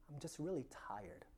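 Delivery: whispered, tired confession; weary whisper. Retold or imitated. A tired congregant whispering to the pastor, weary whisper. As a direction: breathe it out like a tired admission.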